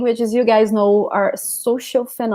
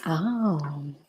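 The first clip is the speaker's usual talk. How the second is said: ordinary talk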